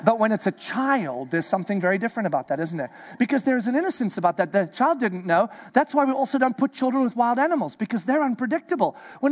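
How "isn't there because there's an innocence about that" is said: ordinary talk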